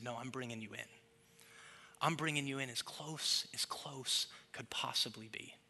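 soft, low promise; hushed, reassuring resolve; tender assurance. Retold or imitated. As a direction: say it gently, as a quiet vow. God tenderly answers those who rejected him.

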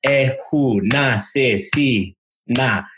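slow, syllable by syllable; clapped-out rhythm. Shown as a demonstration. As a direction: clap out each beat as you say it. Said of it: "clapping it out for you".

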